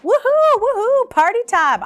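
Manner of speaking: high, loud cheering; high excited whoop; party cheer. Retold or imitated.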